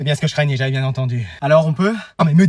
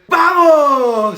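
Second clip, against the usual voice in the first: high, drawn-out celebratory cry; excited cheer; 5 semitones higher than usual. Acted. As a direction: let the cheer ring out, stretched. Cheering while welcoming new Spanish student.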